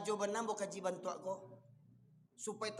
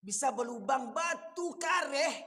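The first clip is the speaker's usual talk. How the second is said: louder, slow stressed questions; emphatic preaching; 5 semitones higher, 6 dB louder, slower than usual. Real, not acted. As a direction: drive each word home. Preacher drives home the stone image. (ceramah tegas dan menekan)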